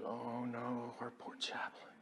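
very quiet, whispered pity; soft pity. Retold or imitated. Lieutenant fearing for the chaplain, soft whisper. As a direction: murmur it near tears.

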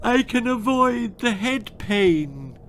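high, whiny plea; tearful bargaining. Acted. Daughter bargaining to skip homework, high whiny plea. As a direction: wheedle through tears.

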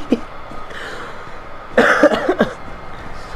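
long wheezing laugh; soft laugh rising at the end; helpless breathy laughter; laughing fit. Real, not acted. Amused by the game.

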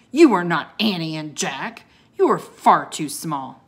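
loud, emphatic dismissal; scornful disbelief. A narrator's reading. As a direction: put them down firmly. Scornful man rejects the children.

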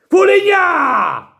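furious yelled name; explosive italian cursing. Real, not acted. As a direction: bellow the name in outrage. Fan rants at goalkeeper.

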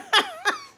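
a short, high real giggle. Real, not acted. Giggling at the little girl, high laugh. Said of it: "I started laughing, my own little laugh".

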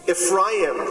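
ordinary talk, nothing marked

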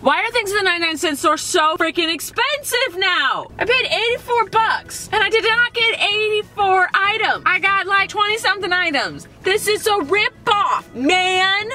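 high, slow, outraged complaint; exasperated rant. Real, not acted. Complaining to viewers about store prices, high exasperated whine.

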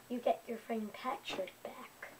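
hushed, secretive whisper. Acted. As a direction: breathe it like a secret. Tempting offer, unvoiced whisper.

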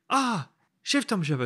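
put-on high, loud French-accented recognition; imitated French accent. French woman realising who is calling, mock French accent. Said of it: "this really deep accent".

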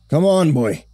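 put-on high, rough old fisherman voice. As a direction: play a gruff old-timer. Old-timer fisherman speaks.

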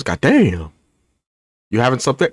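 ordinary talk, nothing marked

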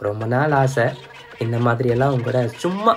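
gentle apology. Acted. Suddenly sweet after power switch.

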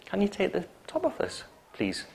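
high, soft request dropping on "please"; polite request. Shown as a demonstration. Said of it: "It's still a polite request".

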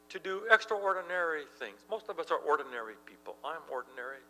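normal talking voice

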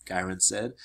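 ordinary delivery

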